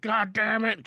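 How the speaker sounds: exasperated mock outburst; mock frustration. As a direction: imitate a fed-up pet owner. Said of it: "you're like".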